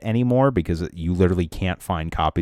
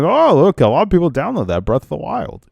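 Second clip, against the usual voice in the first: high, excited imitation of him; mock uploader voice; 9 semitones higher than usual. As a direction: play the greedy uploader. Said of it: "be like".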